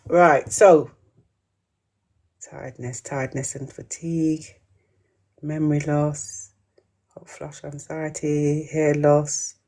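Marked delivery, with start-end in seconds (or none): 2.5-9.6 quiet, low reading to oneself; much softer and lower, half to yourself; under the breath. Real, not acted. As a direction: murmur the list as you scan it. Reads answers under her breath.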